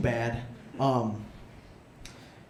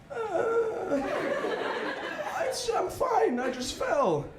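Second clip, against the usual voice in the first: put-on much higher, flustered girly falsetto; girl's voice; much slower than usual. The girl who tripped answering Juan, high flustered falsetto. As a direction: squeak it out embarrassed after falling. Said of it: "And she's like".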